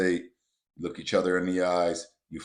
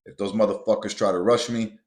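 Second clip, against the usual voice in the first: normal talking voice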